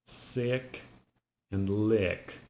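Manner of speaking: "sick" and "lick" with a short relaxed I; short i vowel drill; short I demo. Shown as a demonstration. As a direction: keep the I short. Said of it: "pronounced like lip".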